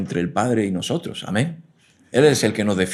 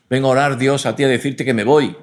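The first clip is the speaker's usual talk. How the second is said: mock stubborn believer; 4 semitones higher, 6 dB louder than usual. Mocking a believer who won't pray.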